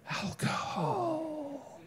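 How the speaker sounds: very high, slow, stretched-out reveal; drawn-out reveal. Shown as a demonstration. Lecturer teasing the audience with the answer, high and drawn out.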